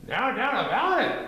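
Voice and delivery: high, eager critter voice; high Gopher voice. A narrator's reading. Gopher agreeing with Pooh, high and eager.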